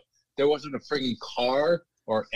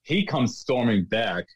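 usual speaking voice

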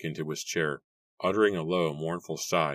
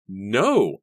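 shout; 5 semitones higher, 8 dB louder than usual. A narrator's reading. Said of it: "shouted in unison".

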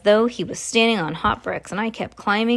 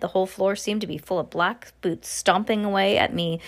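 usual speaking voice

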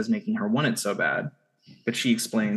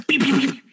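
high, louder beep beep beep; mock beeping; 10 semitones higher, 4 dB louder than usual. Friend imitating a character's beeping, high and loud. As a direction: chirp the beeps like a machine. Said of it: "He's like".

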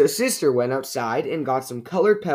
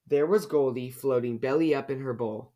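usual speaking voice

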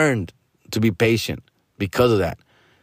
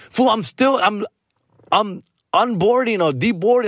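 flustered; 7 semitones higher than usual. Retold or imitated. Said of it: "friend calls again".

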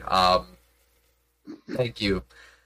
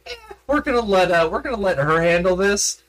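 mock reluctance; 9 semitones higher, 3 dB louder, faster than usual. Retells two players backing off.

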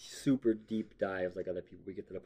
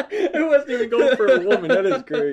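laughing; 12 semitones higher, 10 dB louder than usual. Real, not acted. Laughing loudly at joke.

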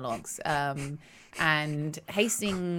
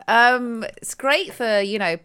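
usual speaking voice

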